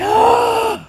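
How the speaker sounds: a sudden high, loud exasperated "Oh!"; exasperated cry. Retold or imitated. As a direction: blurt it out, fed up and alarmed. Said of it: "she is just exasperated".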